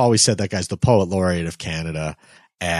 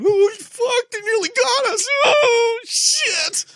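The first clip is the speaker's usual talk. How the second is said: squealing high panicked whimper, mocking; mock panicked Harper; slower than usual. Mocking Harper at the mic after the attack, panicked squeal. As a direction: shriek it like a terrified politician at the mic. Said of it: "Stephen Harper stepping up to the mic".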